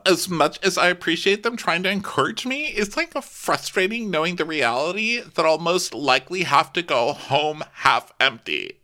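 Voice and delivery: high, whiny self-pitying voice; high sing-song lament; mock gloomy speech. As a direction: whine it like a dramatic defeatist. Imitating Casey's gloomy speech.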